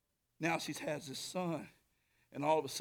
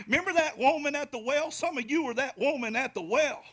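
rising preaching; 10 semitones higher, 9 dB louder than usual. Acted. Preaching rises.

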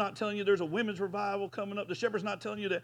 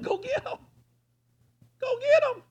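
much higher, building to a loud cry; preacher's rising command; 5 dB louder than usual. Acted. Pastor voicing James to the congregation, climbing shout. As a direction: fire it out as a charge to the church. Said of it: "he says".

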